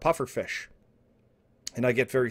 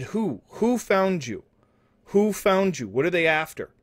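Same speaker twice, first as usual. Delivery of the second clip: urgent questioning; 6 semitones higher, 3 dB louder than usual. Acted. Alarmed questioning of screaming Frank.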